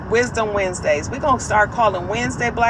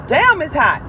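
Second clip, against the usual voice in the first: exasperated complaint; 6 semitones higher, 7 dB louder than usual. Retold or imitated. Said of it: "trying to sit up here and say".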